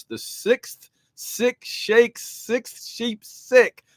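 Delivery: slow, deliberate tongue twister; slow careful tongue twister. Shown as a demonstration. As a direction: enunciate each word slowly. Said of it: "Tongue Twister Madness".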